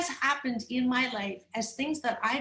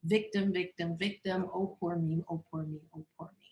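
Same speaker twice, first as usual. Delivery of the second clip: fading mock self-pity, softer and softer; 4 semitones lower, 4 dB quieter than usual. Mocks her own self-pity, fading to nothing. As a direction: whine it, then let it die away. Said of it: "bemoaning what had happened".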